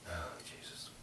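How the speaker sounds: breathy whispered "Jesus"; exasperated whisper; whispered aside. Real, not acted. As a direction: mutter it under your breath.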